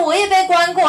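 high, loud quip; indignant retort. Retold or imitated. Trapped woman answering the policeman with a high loud indignant quip. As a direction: blurt it out indignantly.

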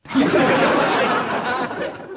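high, breathy burst of laughter; laughing. Real, not acted. Host laughing at his own teasing, real laugh. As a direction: laugh at your own joke.

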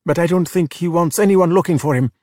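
gently deter him, a little uneasy; nervous. A narrator's reading. Luca discourages Massimo from searching, nervous. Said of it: "replied nervously".

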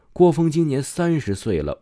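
plain reading voice

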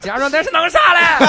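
high, loud, laughing Henan-dialect cop; mock Henan dialect. Joking imitation of the police. (笑着用河南话模仿警察)

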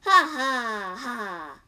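loud, spelled-out villain laugh; mock menacing ha ha ha; hammed-up evil laugh; monster laugh. A narrator's reading. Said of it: "The monster laughed".

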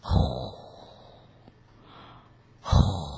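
soft, long blown "hoo"; quiet, voiceless snore sound; imitated snoring breath. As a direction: breathe out slowly like a sleeper. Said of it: "果然听到了一个声音".

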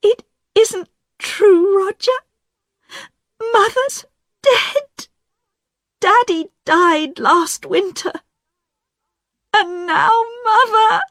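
high, tearful sobbing voice; crying. A narrator's reading. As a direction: weep through the words. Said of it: "Diane began to cry".

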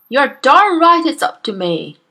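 loud booming bellow. Acted. Headmistress bellows her victory at the teacher, loud. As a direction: bellow it in triumph. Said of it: "Miss Trunchbull bellowed".

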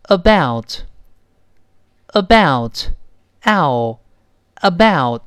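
about, about, ow, about, each said very slowly; about, with the ow gliding from a to o; about, a drawn-out teaching drill; slow model about. Shown as a demonstration. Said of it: "你再读一下这个大概".

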